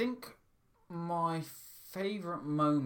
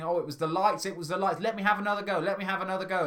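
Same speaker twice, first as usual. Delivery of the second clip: imitated pleading, pitched higher; mild desperate begging; 6 semitones higher, 4 dB louder, faster than usual. Retelling a contestant begging for her life, mildly pleading. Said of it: "she's begging for her life".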